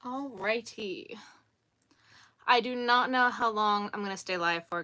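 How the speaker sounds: usual speaking voice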